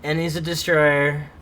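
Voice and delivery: exasperated. Real, not acted. Reading more bad traits.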